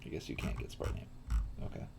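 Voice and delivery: usual speaking voice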